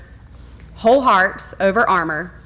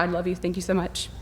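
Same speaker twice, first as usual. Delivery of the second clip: much softer, holding back tears; close to tears; 3 semitones lower, 9 dB quieter than usual. Real, not acted. Principal thanking her closest friend, softly, on the verge of tears. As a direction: say it quietly, about to cry. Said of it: "And now I'm gonna cry".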